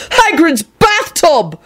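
high, drawn-out outraged scream; acted high-pitched fury. A narrator's reading. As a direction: scream each word in outrage. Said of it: "Neville screamed".

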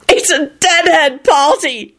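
high, slow yell; a high drawn-out yell. Acted. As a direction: shout it gleefully. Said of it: "Jamie yelled".

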